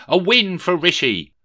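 high, loud victory cry; triumphant cheer. A narrator's reading. Rishi crows over his win, high and loud. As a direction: crow about your win.

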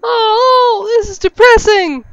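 loud, high, crying-ish whine; a half-joking howl of despair; whiny loud wail. Real, not acted. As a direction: wail it out like it's all too sad. Player whines at a sad scene.

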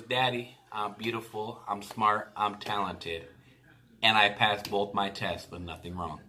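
low, soft, measured report; understated retold daughter. Daughter reports passed tests to dad, soft and low. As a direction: tell dad softly, one item after another.

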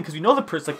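usual speaking voice

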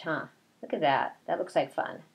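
ordinary delivery

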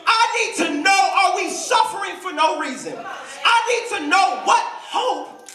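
high, insistent cry to God; pleading as the desperate John; anguished plea. Acted. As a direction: demand an answer, pitch leaping up.

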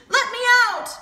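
very high, loud demand; shouting. A narrator's reading. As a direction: yell it from inside a trap. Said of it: "shouted Ostrich".

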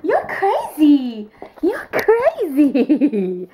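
slow, laughing through the words. Real, not acted. Laughing at her crazy dog, slow and giggly. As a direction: laugh at the dog while saying it.

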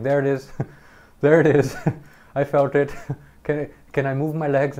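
high, loud, laughing through the panic; panicked nervous laughter. Retold or imitated. Patient panicking during spinal tap.

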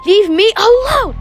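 high, theatrical cry to be left alone; exasperated, acted yell; angry outburst. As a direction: cry out, pitch rising sharply. Character rejects the tempter's offer.

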